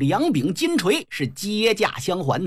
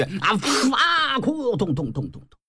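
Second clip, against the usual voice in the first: imitated death and fall; 3 semitones higher than usual. Storyteller imitates the second brother falling, burst, cry and thuds. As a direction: act out a hit and a collapse.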